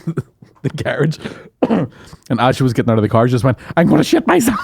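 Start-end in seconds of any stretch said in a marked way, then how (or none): 3.8-4.6 high, urgent cry of a mum in a panic; panicked mum. Retold or imitated. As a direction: blurt it out like it can't wait. Mum panicking at the petrol station.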